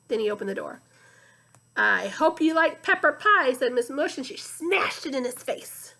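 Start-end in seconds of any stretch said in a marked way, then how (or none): 1.8-3.5 sloshy muddy voice. Acted. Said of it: "like two boots sloshing through the mud".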